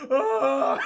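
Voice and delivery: loud mock sob; mock wail. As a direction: let out a hammed-up anguished cry. Monster's anguished reply.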